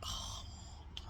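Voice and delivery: soft whispered dismay; whispered groan. Retold or imitated. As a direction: breathe it out defeated. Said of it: "And you be like".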